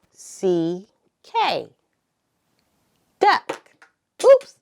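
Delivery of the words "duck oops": sudden high loud oops; a startled squeal at a dropped letter; surprised oops. Real, not acted. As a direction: caught off guard as a card falls. Letter falls off board.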